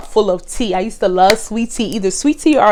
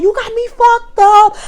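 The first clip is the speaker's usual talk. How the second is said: high, slow imitated yell back; mock yell; 12 semitones higher, slower than usual. She yells back at him.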